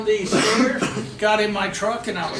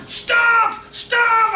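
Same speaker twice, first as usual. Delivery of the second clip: loud, high-pitched yell of stop; retold scream; 14 semitones higher, 6 dB louder than usual. Woman screaming at the driver backing down, imitated shouting. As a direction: holler it out like someone running at a truck. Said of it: "someone started screaming at me".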